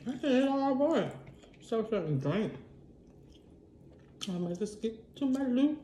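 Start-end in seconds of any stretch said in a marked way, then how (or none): none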